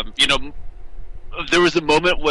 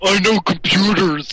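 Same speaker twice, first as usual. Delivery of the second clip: mock interviewee voice; 5 semitones higher than usual. Mocks deep-voiced interview answer.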